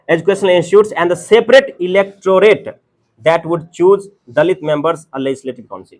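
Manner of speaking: slow, deliberate textbook read-out; reading aloud. Acted. Teacher reads the notes on separate electorate, slow read-aloud. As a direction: read each phrase out with weight.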